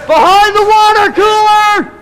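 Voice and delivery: loud, slow and high warning; shouted callout. Real, not acted. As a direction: call across the room to teammates. Calling enemy position to team.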